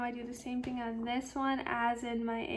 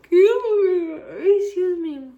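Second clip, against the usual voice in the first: yawn; 5 semitones higher, 13 dB louder, slower than usual. Real, not acted. Sudden noise mid-rant.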